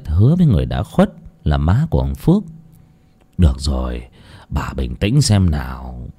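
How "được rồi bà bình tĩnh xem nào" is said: calming husband. Acted. Husband calms his wife.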